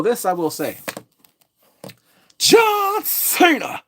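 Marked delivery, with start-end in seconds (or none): 2.4-3.8 drawn-out, high ring announcer shout of "John Cena"; ring announcer call. Pretend. Hyping the viewers up with a wrestler's entrance call. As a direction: belt the name out slowly like an announcer.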